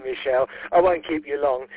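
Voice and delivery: usual speaking voice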